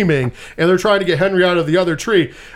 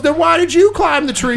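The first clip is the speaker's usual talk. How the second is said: incredulous retort; 10 semitones higher than usual. Retold or imitated. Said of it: "He goes".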